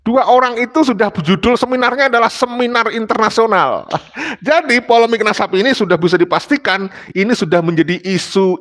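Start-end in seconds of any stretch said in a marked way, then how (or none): 3.9-4.4 brief, higher-pitched soft chuckle; amused chuckle. Real, not acted. Speaker joking about a two-country international seminar, amused chuckle. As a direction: let out a small real laugh after the punchline. Laughs at the seminar's grand title.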